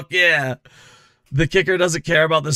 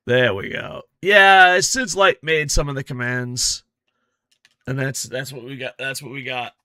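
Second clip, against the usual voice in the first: normal talking voice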